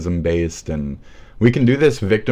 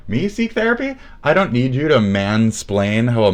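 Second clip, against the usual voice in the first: much higher, mocking "me seek therapy?"; mock outraged man; 4 semitones higher than usual. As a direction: squeak it out in disbelief.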